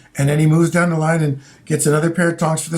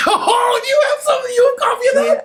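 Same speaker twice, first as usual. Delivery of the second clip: excited squeal. Real, not acted. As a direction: squeal with sudden delight. Excited about finding Gong Show copy.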